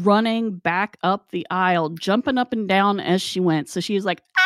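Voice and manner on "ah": high imitated scream; piercing scream. Coworker running up the aisle, piercing scream. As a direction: shriek like a panicked coworker. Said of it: "this piercing scream".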